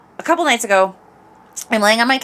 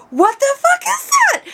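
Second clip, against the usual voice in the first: startled shout. Retold or imitated. Woken on the couch, she shouts at the noise in alarm. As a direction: yell it in sudden alarm.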